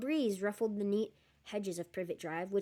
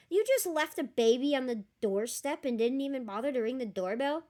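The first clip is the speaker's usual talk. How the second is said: louder, outraged disbelief; incredulous; 4 semitones higher, 7 dB louder than usual. Real, not acted. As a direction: call out the absurdity. Reader reacts in disbelief.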